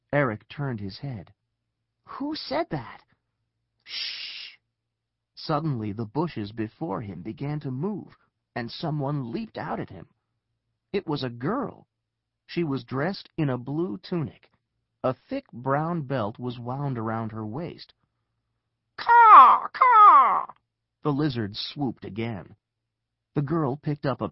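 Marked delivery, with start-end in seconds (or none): 2.0-3.0 high, nervous question; startled question. Acted. Eric to unseen voice in bushes, high startled question. 3.8-4.6 soft voiceless shush; hushing shh. Acted. Hidden girl silencing Eric, soft urgent shush. 19.0-20.5 very high, very loud caws; lizard screech. A narrator's reading. Giant lizards diving at Eric, piercing loud screeches. Narrator voices swooping lizards.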